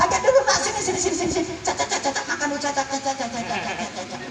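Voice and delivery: soft coaxing coo, fading nearly to nothing; baby the grown child with a hushed sing-song; cooing softly. Retold or imitated. Mother cooing at her grown teen as if feeding a baby, hushed.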